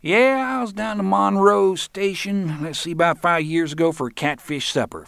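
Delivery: very high, loud drawling character voice. A narrator's reading. Roland recalling a catfish supper at the bar, high drawl. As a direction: drawl it out in a high mocking-storyteller voice. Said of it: "drawled in a high voice".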